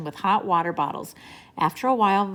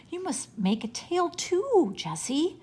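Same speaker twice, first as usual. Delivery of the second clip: put-on high, drawn-out little-boy plea; begging; 10 semitones higher, slower than usual. A narrator's reading. Benny asks Jessie for a bear tail, begging. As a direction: plead slowly, voice lifting high. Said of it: "begged Benny".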